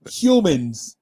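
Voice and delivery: usual speaking voice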